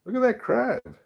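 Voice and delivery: real thrill at a crab picture. Real, not acted. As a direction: squeal over the drawing.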